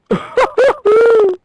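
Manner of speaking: high, loud goofy laugh in character; Sloth laugh. Retold or imitated. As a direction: cackle like Sloth.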